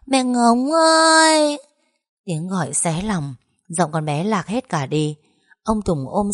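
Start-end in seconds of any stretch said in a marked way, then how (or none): 0.1-1.6 put-on high, slow little girl's wail; a child's broken sobbing cry; sobbing call. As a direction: cry out for her mother through sobs. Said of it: "nức nở òa lên gọi".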